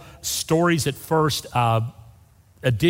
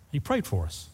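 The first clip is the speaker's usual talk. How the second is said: usual speaking voice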